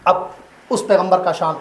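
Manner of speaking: ordinary talk, nothing marked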